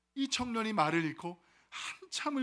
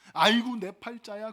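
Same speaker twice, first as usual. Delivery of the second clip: loud mock moan of self-pity; acted grumbling; 2 semitones higher, 7 dB louder than usual.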